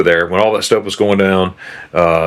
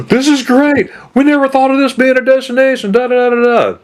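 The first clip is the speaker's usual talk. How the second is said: gasp, then gush like a thrilled tourist. Retold or imitated. Retired principal raving by message about her Germany trip, gushing high. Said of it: "I got messages left and right".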